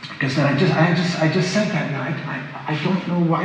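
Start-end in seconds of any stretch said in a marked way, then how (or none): none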